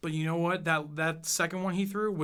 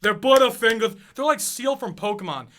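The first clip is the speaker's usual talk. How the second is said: ordinary talk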